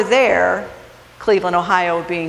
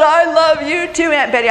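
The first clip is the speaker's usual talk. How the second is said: raised, high voice easing at the end; mock shout back; 8 semitones higher, 4 dB louder than usual. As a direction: imitate a niece calling back. Acting niece shouting back on ride.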